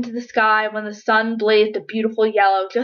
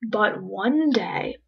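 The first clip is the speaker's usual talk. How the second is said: ordinary delivery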